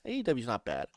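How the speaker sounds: dropped right down, almost to oneself; quiet aside. Real, not acted. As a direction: say it under your breath. Repeats the remark as an aside.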